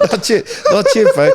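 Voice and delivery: high, loud laughing reply; cracking up while playing along. Real, not acted. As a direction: answer through a big laugh. Laughs back at the joke.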